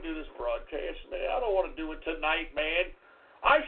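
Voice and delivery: low, quick, hushed defeated mumble; deflated whine. Acted. Host gives up on his broadcast, deflated whine.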